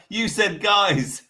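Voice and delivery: slow words through real laughter; laughing. Real, not acted. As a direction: laugh while saying it. Laughing at Chicken's posh voice.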